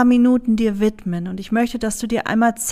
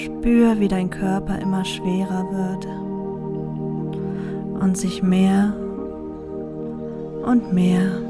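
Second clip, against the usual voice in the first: soft spa whisper; 2 semitones lower, 6 dB quieter than usual. Acted. Leads a meditation over music. (ruhige, langsame Meditationsstimme)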